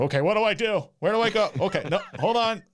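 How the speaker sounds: loud, high, confused rush; panicking over a long list of rules; flustered. Pretend. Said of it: "I can just picture someone outside with a big list".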